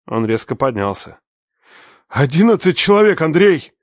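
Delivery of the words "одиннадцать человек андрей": much higher and louder cry of rage; pained fury. A narrator's reading. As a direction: pain breaking through anger. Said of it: "прорезалась боль ... спрятанная за яростью".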